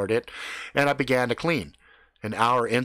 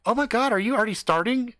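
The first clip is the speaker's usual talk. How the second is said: high, quick exclamation; surprised; 10 semitones higher, 3 dB louder, faster than usual. Retold or imitated. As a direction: blurt it in amazed, higher-pitched voice. Karen walks in, surprised.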